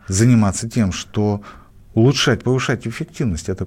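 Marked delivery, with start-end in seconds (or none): none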